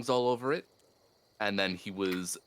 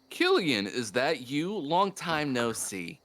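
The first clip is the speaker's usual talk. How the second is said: higher, swooping greeting, drawn out at the end; quoted kid's greeting; 6 semitones higher, slower than usual. Acted. Game master quoting the kid to Killian, playful high greeting. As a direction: greet a long-lost acquaintance, pitched up. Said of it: "you heard something along the lines of".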